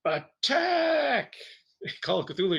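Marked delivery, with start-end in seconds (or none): 0.1-1.3 high yelled battle cry. Acted. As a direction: charge in with a shout. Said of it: "everybody's like".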